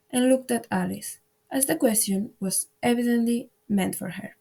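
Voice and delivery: usual speaking voice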